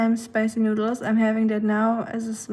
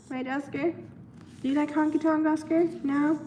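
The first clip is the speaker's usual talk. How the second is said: pet talk; 5 semitones higher, 4 dB quieter than usual. Real, not acted. Talking to her pet Oscar.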